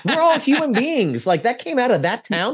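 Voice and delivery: high, incredulous Pittsburgh locals gawking; amazed locals. Retold or imitated. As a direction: play the stunned locals, voice climbing in disbelief.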